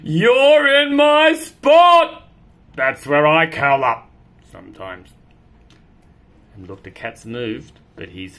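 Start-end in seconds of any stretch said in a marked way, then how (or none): 0.0-5.1 loud, high, slow protest; outraged cat. Acted. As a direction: stretch it out, offended.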